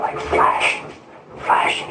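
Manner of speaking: normal talking voice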